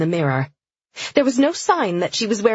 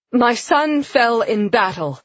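slow, raised funeral address; solemn proclamation; 4 semitones higher, 3 dB louder, slower than usual. A narrator's reading. Sienna addressing mourners at her son's funeral, solemnly proclaiming. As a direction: proclaim it slowly, pitched up to carry. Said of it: "Her voice echoed on the air".

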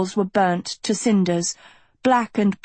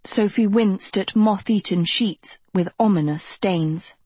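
plain reading voice

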